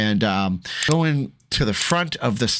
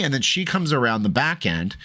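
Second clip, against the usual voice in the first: ordinary delivery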